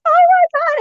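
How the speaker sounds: high, loud, tearful outburst reliving the moment; tearful cry. Retold or imitated. To a bird she believes is Marion, tearful loud cry. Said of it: "And I'm crying".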